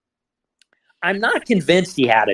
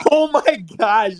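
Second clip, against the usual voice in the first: shocked gasp; 6 semitones higher, 7 dB louder than usual. Real, not acted. Reacting to the shocking clip.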